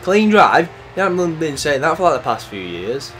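high, loud disbelief; incredulous retort. Real, not acted. Gamer answering the race announcer, loudly incredulous.

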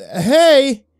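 loud, high yell into a storm; shouting. Acted. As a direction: call out hard over the wind. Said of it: "called out again".

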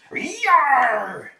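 pirate yell. Acted. Pirate captain roleplay cheer.

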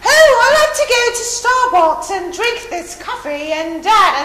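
put-on high, loud affected accent; exaggerated TV-learned accent; mock put-on accent. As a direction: parody someone faking a foreign accent. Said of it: "when they do that accent thing".

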